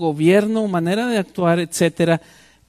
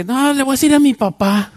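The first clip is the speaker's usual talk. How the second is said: put-on higher, louder bratty kid voice; child's threat; 7 semitones higher, 6 dB louder than usual. Schoolchild threatens teacher with his dad, bratty whine. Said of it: "child threatening the teacher".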